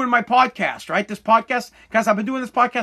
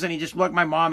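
normal talking voice

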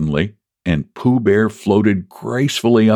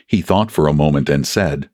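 usual speaking voice

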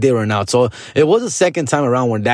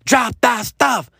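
high, slow shouted order; mock angry parent yelling; yelling dad; 10 semitones higher, much slower than usual.

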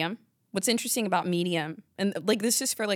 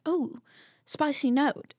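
lightly imitated wing connoisseur, higher pitched; 7 semitones higher, slower than usual. Imitating a singer tasting hot wings, connoisseur manner. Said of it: "She's like".

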